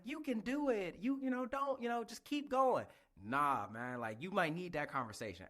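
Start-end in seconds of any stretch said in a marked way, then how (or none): 0.0-2.9 high, sing-song cheerleading; mocking everyone's pep talks; mock encouragement. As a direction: parody of empty encouragement. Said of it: "everybody's like".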